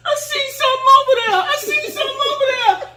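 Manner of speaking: put-on high, fast, frightened child's voice; imitated scared daughter. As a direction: squeal it like a scared little girl. Daughter screaming, retold.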